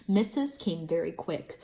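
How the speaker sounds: plain reading voice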